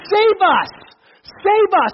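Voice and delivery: high, loud pleading cry; crowd crying out. Acted. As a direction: cry out like the crowd begging their king. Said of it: "and we're gonna sing".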